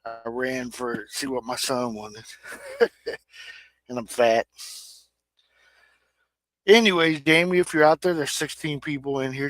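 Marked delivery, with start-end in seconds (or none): 2.5-3.2 short high self-mocking giggle; a quick giggle at his own joke. Real, not acted. Host on a stream laughing at himself, high giggle.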